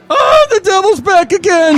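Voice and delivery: loud falsetto alarm; Floyd the Floor Man. Acted. Floor cleaner spotting the devil again, alarmed. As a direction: shriek it like a cartoon hero. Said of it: "this is Floyd the Floor Man".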